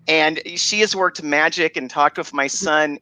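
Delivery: usual speaking voice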